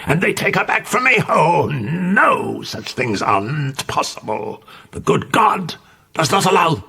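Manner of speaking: high, desperate lament of a mad mother; frantic old mother. A narrator's reading. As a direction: rave in grief and disbelief.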